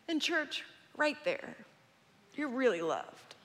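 softened voice after the yelling; softly sincere. Real, not acted. Preacher turning to his church, softly sincere. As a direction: drop the volume and say it to them.

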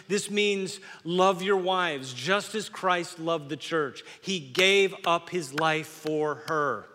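slow, deliberate scripture read. Acted. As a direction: read the verse slowly, weighing each word. Reads scripture slowly.

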